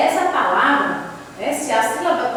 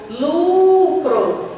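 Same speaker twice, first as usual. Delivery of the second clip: LUCRO with a long, raised LU, then short cro; calling-out stretch; 4 semitones higher, 4 dB louder than usual. Shown as a demonstration. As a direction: call out lucro, holding the u of lu. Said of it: "É só fazer o chamamento".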